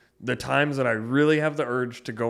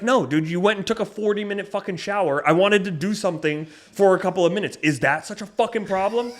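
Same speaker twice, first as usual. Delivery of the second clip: higher, louder exasperated complaint; retold frustration; 8 semitones higher, 4 dB louder than usual. Complaining to his girlfriend about her long shower, exasperated. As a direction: argue it like you're still annoyed. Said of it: "that's how I'm feeling out there".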